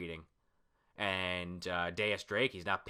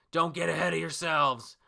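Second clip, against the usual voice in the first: loud, raised voice of a fighter; battle warning; 7 semitones higher, 6 dB louder than usual. Acted. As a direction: call it out to cut the enemy down to size. Acted warning to enemies in battle.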